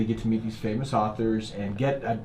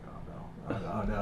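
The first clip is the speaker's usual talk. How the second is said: retold terror, hushed; 3 semitones lower, 11 dB quieter than usual. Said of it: "I'm just, I'm terrified".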